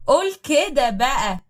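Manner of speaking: bang the table and shout it; loud accusation. Acted. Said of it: "وقالت بصوت عالي". (صياح غيرة عالٍ)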